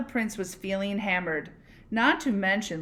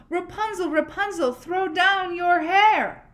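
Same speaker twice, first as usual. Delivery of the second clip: higher, louder call up to the tower; prince calling up; 10 semitones higher, 6 dB louder than usual. Acted.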